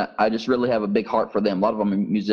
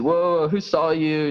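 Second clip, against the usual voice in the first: high, slow startled whoa; retold alarm; 6 semitones higher, slower than usual. Friend Mike startled that the new converts were seen, slow high whoa. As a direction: play the friend caught off guard.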